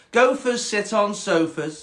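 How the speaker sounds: high, slow snobby cat. Acted. Cat denying the frog a sofa, snobby and slow. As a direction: lay down the rule snootily. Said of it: "quite a snobby cat".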